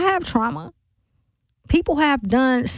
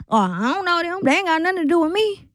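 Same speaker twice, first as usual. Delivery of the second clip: higher, quicker imitation of indifferent people; dismissive quote; 6 semitones higher, faster than usual. Host mimicking people who don't care about strangers, dismissive. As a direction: brush it off in someone else's higher voice. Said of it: "I know people like that too".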